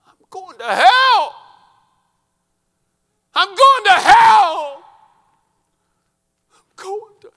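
high, drawn-out scream of terror; anguished scream. Retold or imitated. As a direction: scream it in agony, each word stretched out. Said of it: "our boy screaming".

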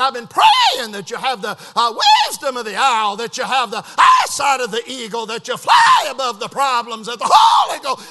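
loud whooping preacher, leaping high; phone message impression. Preacher mimics a friend praying for him, whooping impression. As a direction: holler each key word like a fervent preacher. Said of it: "just like it was on the phone".

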